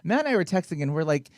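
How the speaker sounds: normal talking voice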